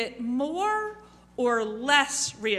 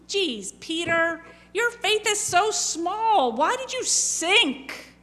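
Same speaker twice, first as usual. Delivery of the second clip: higher, louder scolding tone; chastising; 6 semitones higher, 4 dB louder than usual. Retold or imitated. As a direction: scold him like a disappointed teacher. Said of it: "Jesus is chastising Peter".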